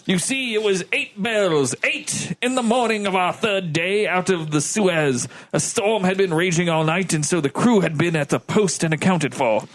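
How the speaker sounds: formal officer giving testimony; a hint of stiff naval officer; lightly voiced ship's lieutenant. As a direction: read it out as a lightly acted witness statement.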